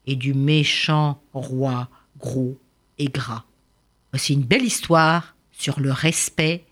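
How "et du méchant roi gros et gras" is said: slow ending. A narrator's reading.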